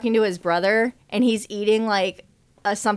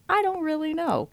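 mouth full; 7 semitones higher, 4 dB quieter than usual. Retold or imitated. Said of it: "Remy goes / He goes".

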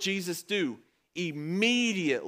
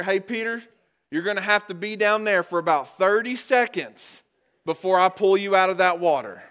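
higher, louder mock-stern demand; mock scolding; 5 semitones higher, 7 dB louder than usual. Imagined rescuer making Peter wait, mock scolding. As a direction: lay down conditions like a stern rescuer, hammed-up.

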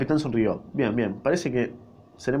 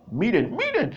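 higher, excited call out; shouting; 10 semitones higher, slower than usual. A narrator's reading. Lustig yells to the crew, excited, higher pitched. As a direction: call the others to look. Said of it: "Gritando como un maricón".

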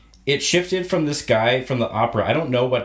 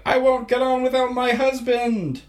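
imitated protesting wife; 12 semitones higher than usual. Wife refusing a lifeboat without her husband, high mock protest. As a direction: put on a high-pitched wife refusing to leave. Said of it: "he hears her being like".

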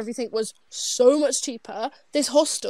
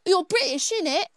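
higher-pitched mock British accent; jokey, exaggerated British slang accent; caricature British accent; 6 semitones higher than usual. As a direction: parody a British street accent. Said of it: "That's what it sounds like".

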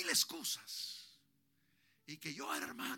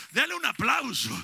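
loud, rousing call to the congregation; preacher urging applause at full voice; shouted call; 5 semitones higher, 13 dB louder than usual. Real, not acted. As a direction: shout it out to get them clapping. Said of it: "Póngase de pie".

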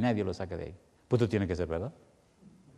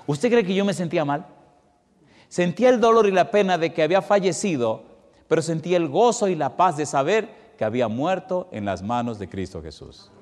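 louder, higher climax of the sermon; impassioned preaching; 6 semitones higher, 6 dB louder than usual. Acted. Pastor asking the church how he felt at his mother's funeral, impassioned preaching. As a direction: build to an impassioned peak.

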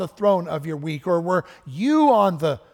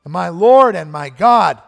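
slow, much louder proclaiming cry; 3 semitones higher, 13 dB louder than usual. Retold or imitated. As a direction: belt it out slowly like a confession of faith. Preacher voicing Thomas's cry.

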